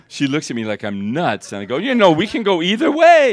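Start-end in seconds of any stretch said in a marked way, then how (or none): 1.8-3.3 much higher and louder, teasing and gleeful; playful excitement. Retold or imitated. Offering the patient a choice, loud and teasing. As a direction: sell it like a cheerful game host.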